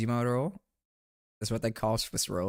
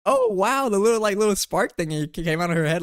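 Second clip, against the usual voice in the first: louder, higher excited burst; 8 semitones higher, 6 dB louder than usual. Real, not acted. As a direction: blurt it out in delight. Excited exclamation at photo.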